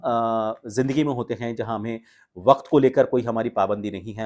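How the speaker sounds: normal talking voice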